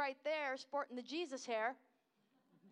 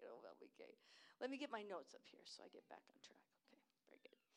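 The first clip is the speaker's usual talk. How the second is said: whispered aside; 11 dB quieter, much faster than usual. Real, not acted. Aside while finding notes.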